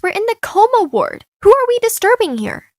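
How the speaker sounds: high-pitched, sassy comeback; indignant retort. Acted. Quarrel with the nurse.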